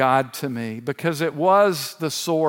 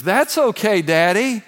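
put-on higher, louder, bright child's reassurance; cheerful little boy; 5 semitones higher, 4 dB louder than usual. Comforting his devastated dad, little son's higher, eager, cheerful reply. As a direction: imitate a small boy eagerly offering help.

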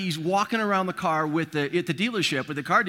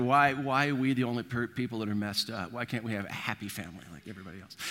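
mock lament; 5 semitones lower, 6 dB quieter, faster than usual. Friends lamenting.

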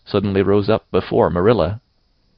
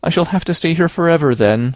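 put-on high, doleful girl's voice; mournful resignation; 9 semitones higher, 4 dB louder than usual. A narrator's reading. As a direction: lament it in a high child's voice. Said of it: "said Anne mournfully".

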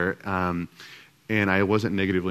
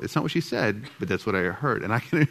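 usual speaking voice

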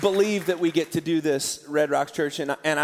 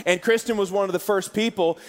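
ordinary talk

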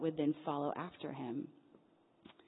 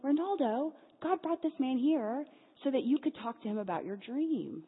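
excited; 7 semitones higher than usual. Retold or imitated. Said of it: "I'm like".

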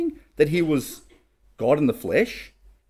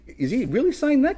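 pitched way up in disbelief; incredulous high question; 10 semitones higher than usual. Retold or imitated. Pharisees asking Jesus in disbelief, high incredulous voice. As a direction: voice the Pharisees' stunned doubt.

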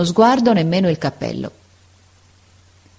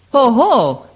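high, loud outraged cry; angry exclamation; 8 semitones higher, 5 dB louder than usual. Acted. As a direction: explode in offended anger. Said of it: "esclamò Votini, pien di rabbia". (esclamazione rabbiosa, alta e forte)

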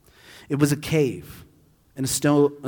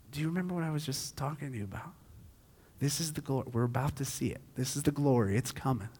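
hushed; 10 dB quieter, faster than usual. Retold or imitated. Jesus teasing Martha, sparkle in eye.

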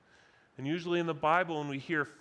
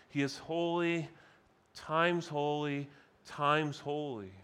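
slow, deliberate "holy times holy times holy"; stress on holy. Shown as a demonstration. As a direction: land each "holy" separately and weightily. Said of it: "crying out that God is three times holy".

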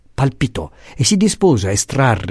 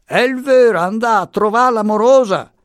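much higher, louder shout; shouting into her face; 12 semitones higher, 4 dB louder than usual. A narrator's reading. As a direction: yell it right into a deaf ear. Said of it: "le gridò sul viso".

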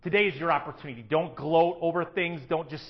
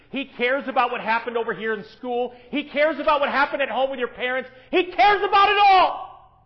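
building to a shout; 8 semitones higher, 8 dB louder than usual. Acted. Pastor to congregation, building to a shouted climax. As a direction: climb to a full preaching peak. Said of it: "preacher builds to climax".

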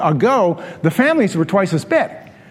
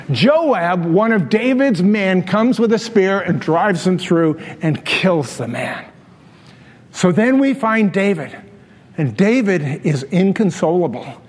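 ordinary talk

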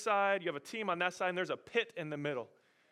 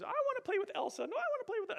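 put-on much higher, fast childish whine; squabbling little girls; 4 dB quieter, much faster than usual. Daughters arguing over Barbies, high little-girl whine. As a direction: whine like a small girl fighting over a doll.

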